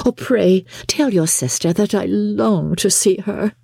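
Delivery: high, pleading lady admirer; Miss Bingley, gushing. A narrator's reading. Lady sending fond messages to his sister, gushing.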